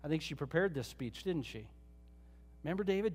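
usual speaking voice

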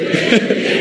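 brief, light laugh to himself; short chuckle. Real, not acted. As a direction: chuckle at your own overdone demo. Chuckles after exaggerated stress demo.

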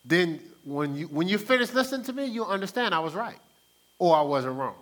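loud, pushy mock know-it-all; self-righteous. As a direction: lecture like you're always right. Mock self-righteous lecture to partner.

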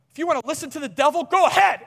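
fast, high mock tantrum; upset child yelling. Crying son lashing out at his dad, fast high mock yelling. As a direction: imitate a crying kid's yelled dare, rushing it. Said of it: "he's still crying".

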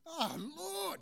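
high, loud cry to the Lord; relieved exclamation. Acted. Stranded man answers God.

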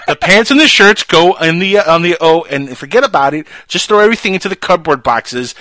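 loud hammed-up flustered mom; fussy mom impression. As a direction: rattle off chores like a frazzled mother. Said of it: "she's like".

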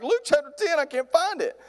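high-pitched comic panic; freaking out. Acted. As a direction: act out flustered panic, voice way up. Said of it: "I just start freaking out".

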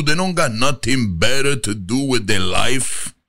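a fed-up Italian dad; Italian father. Retold or imitated. Italian father complaining about American kids, Italian accent. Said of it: "My Italian father".

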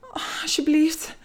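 slow, high, mildly moaned plea; whispering and moaning. A narrator's reading. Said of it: "zegt ze fluisterend en kreunend".

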